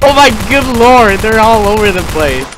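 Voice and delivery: high, loud shocked cry; alarmed exclamation. Real, not acted. Gamer finding zombies all over, loud alarmed cry. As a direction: yell out as the threat swarms in.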